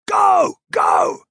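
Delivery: loud high urgent yells; yelling. A narrator's reading. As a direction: scream it down the hill. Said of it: "He heard yells from above".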